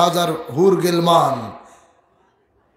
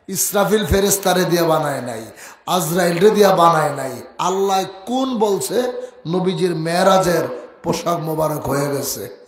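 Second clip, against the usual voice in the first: ordinary talk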